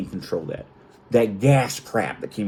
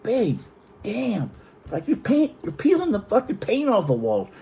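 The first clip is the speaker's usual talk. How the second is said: high-pitched retold protest; exasperated complaint; 10 semitones higher than usual. Husband's retold complaint over farting.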